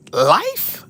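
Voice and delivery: very high rising yelp of disbelief; shocked disbelief. Retold or imitated. Retelling the life sentence to listeners, shocked disbelief. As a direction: squeak it out, stunned.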